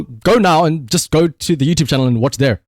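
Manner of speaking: ordinary talk, nothing marked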